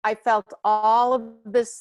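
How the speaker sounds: normal talking voice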